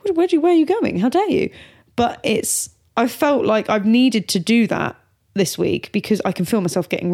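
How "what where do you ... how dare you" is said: high, rapid outraged questions; indignant toddler. Retold or imitated. Mother voicing daughter outraged that mummy is leaving. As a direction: play the offended little girl. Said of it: "It's a proper, like".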